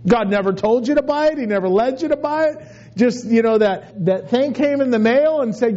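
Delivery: ordinary delivery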